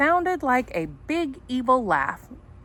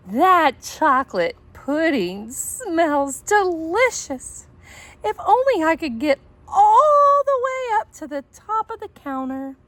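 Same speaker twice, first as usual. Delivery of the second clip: put-on higher girlish storybook voice; little girl voice; 8 semitones higher, 5 dB louder than usual. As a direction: voice Goldilocks wishing for the pudding.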